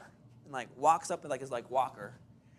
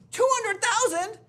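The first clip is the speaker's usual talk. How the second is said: high, loud, slow incredulous cry; shocked disbelief; 8 dB louder, slower than usual. Retold or imitated. Realtor learns he was outbid at his own price, shrieking disbelief. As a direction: squeal the number in disbelief.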